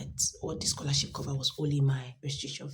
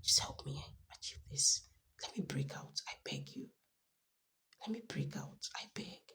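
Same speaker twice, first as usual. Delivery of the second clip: soft pleading whisper to God; hushed desperate prayer; 8 dB quieter than usual. Retold or imitated. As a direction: beg quietly, almost breathless. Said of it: "I said".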